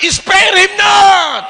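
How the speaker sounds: high, slow, drawn-out command; shouted preaching. Real, not acted. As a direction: cry it out with full force. Preacher shouting at the pretend-deaf.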